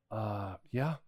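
put-on a nervous boy barely answering his dad; timid kid's answer. Son answering dad, fearing trouble.

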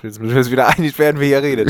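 laughing through the words; giggly and quick. Real, not acted. Laughing while sorting who talks.

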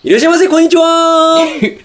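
high sing-song store greeting; customer service voice. Shown as a demonstration. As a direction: call it out like a Book-Off clerk. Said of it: "そのテンションね". (高い声で歌うような店員の挨拶)

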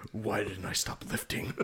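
breathy, unvoiced whisper of self-pity; mock whisper. Joking as the oversized Kingpin stuck in a truck, whispered. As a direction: hush it like a private regret.

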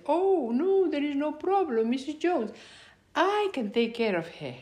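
high, singsong imitation of an obliging doctor; eager volunteering. Acts doctor eagerly volunteering himself.

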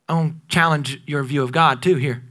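normal talking voice

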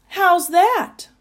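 high, proud challenge; triumphant. A narrator's reading. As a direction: show off to the doubter. Giraffe triumphs over the doubting Duke.

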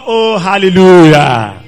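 loud, slow hallelujah; drawn-out loud shout of hallelujah; praise shout. Real, not acted. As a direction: lift up the praise. Preacher exclaims in praise.

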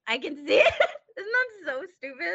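laughing. Real, not acted. Cringing laugh at memory.

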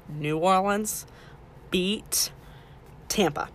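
"New Orleans" then "beat" then "Tampa", each word set apart by pauses; slow emphatic repeat. Shown as a demonstration. Host drilling the score for listeners, word by word with pauses. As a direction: spell it out word by word so it sticks.

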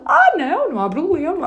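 high, bright mock-friendly voice, laughing; lightly hammed-up neighbour, eager to help; imitating the neighbour. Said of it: "E ele".

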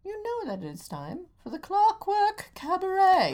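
high, loud, slow showman announcement; announcer voice. Acted. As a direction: boom it out like a cabaret host. Show intro announcement.

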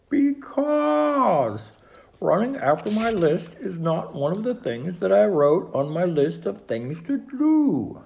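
high, emphatic Toad voice; exasperated protest. A narrator's reading. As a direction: spell it out in indignant protest. Toad protesting after his shout.

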